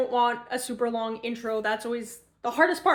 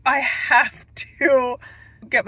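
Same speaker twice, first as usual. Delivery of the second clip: slow words broken by real laughter; laughing; 8 dB louder, slower than usual. Real, not acted. Vlogger recalling a hilarious audiobook scene, laughing out loud. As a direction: laughs through the words, barely holding it together.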